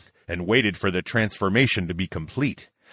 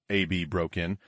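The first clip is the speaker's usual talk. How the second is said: ordinary delivery, nothing marked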